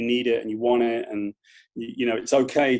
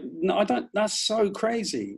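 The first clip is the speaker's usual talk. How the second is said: ordinary delivery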